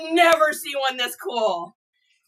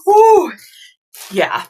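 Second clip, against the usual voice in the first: ordinary talk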